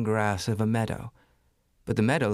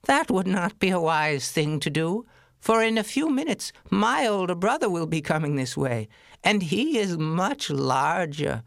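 higher, pleading goat voice; 8 semitones higher than usual. Acted. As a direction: bargain nervously like a goat.

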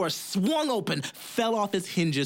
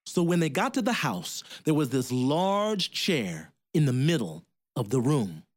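ordinary delivery

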